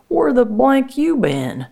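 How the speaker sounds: high-pitched, put-out brother's demand; annoyed demand. Acted. Brother walks up to sister he was looking for, annoyed. Brother confronts her in acted quarrel.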